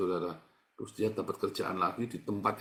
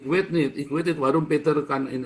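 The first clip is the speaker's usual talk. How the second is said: louder, higher phone talk; raised voice on the phone; 4 semitones higher, 6 dB louder than usual. Real, not acted. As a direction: call it across the line.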